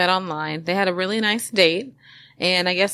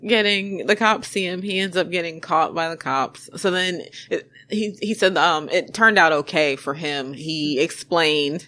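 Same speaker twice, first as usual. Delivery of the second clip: ordinary delivery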